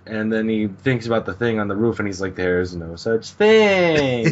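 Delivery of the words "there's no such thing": slow, elongated protest with extra volume; drawn-out denial. Retold or imitated. Hijikata remembering the thing on the roof, drawn-out denial. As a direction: stretch it out slowly, louder.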